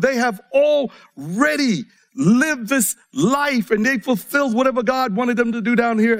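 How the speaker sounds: usual speaking voice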